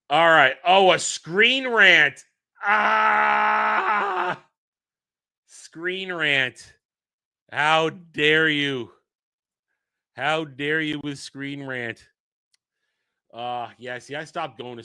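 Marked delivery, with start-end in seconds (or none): 2.6-4.4 loud yelp of disgust; mock outrage yell. Real, not acted. Reacting to Screen Rant. 7.5-12.1 slow, drawn-out mock outrage; mock indignation. Mock offense at donor.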